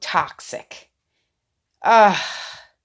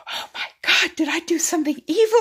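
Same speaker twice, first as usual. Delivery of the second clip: mock panic; 11 semitones higher, 4 dB louder, faster than usual. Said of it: "I was like".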